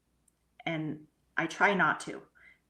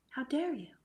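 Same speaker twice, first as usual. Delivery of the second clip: higher, outraged "How dare you"; mock indignant cry; 7 semitones higher than usual. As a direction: throw the outrage back at it, pitched up. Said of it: "I'm so mad".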